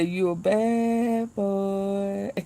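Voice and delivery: slow, drawn-out taunting voice; mock taunt. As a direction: drag it out like a jailhouse taunt. Guards taunting the prisoner.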